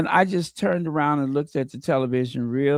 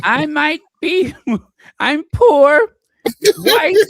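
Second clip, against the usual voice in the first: high, loud, hammed-up dramatic farewell; Victoria Arbiter's parting words; 11 semitones higher, 9 dB louder, slower than usual. Co-host acts out a fired pundit's parting words, mock-dramatic. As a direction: proclaim it in a pitched-up mock-heroic voice. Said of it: "Victoria Arbiter's parting words".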